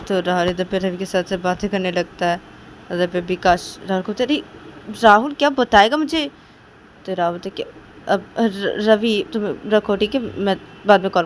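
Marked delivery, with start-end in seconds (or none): 4.1-6.3 louder, higher demand; demanding call. Acted. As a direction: press him to spill it now. Vikas demanding an answer.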